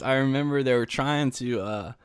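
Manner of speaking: ordinary talk, nothing marked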